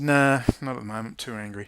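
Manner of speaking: loud, dismissive refusal; retold brush-off. Retelling turning down Iran Press TV, loud brush-off. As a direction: bark the refusal back. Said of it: "I said".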